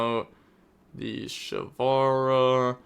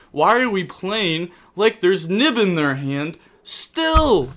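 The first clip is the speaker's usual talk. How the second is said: exasperated question; 6 semitones higher, 4 dB louder, slower than usual. Real, not acted. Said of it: "I'm actually heated".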